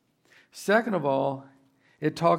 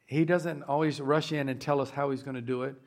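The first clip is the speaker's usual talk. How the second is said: ordinary talk, nothing marked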